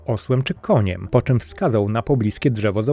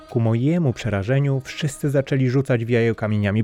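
ordinary delivery, nothing marked

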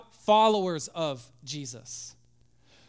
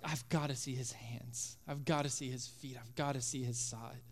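much softer, low pleading; quiet desperation; 7 semitones lower, 12 dB quieter than usual. Acted. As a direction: beg quietly. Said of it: "It's like".

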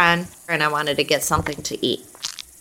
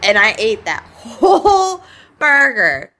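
laughing; 5 semitones higher, slower than usual. Real, not acted. Craving burger after release.

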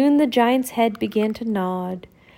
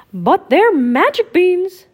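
high, slow insisting; Mickey defending his trade; pleading Mickey; 9 semitones higher, 8 dB louder than usual. Acted. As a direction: insist on the magic. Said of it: "said Mickey".

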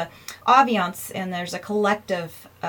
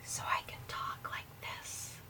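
breathy ASMR whisper; 18 dB quieter than usual. Shown as a demonstration. Host demoing ASMR for viewers, whispered. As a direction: whisper it close to the mic. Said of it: "I did the whispering".

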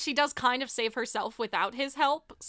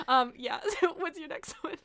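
normal talking voice

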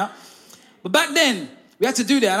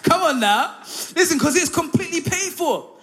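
laughing; 7 semitones higher, 3 dB louder than usual. Real, not acted. Laughing at the story.

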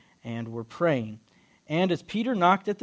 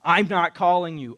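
loud, raised-voice denial; louder, insistent correction; exasperated Eli; 3 semitones higher, 9 dB louder than usual. Retold or imitated. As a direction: tell him off emphatically. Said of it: "Eli's like".

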